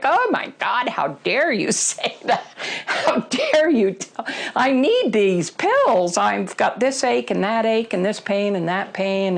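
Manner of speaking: retold indignant outburst, leaning hard on the key words; indignant protest. Retold outraged protest at being confronted.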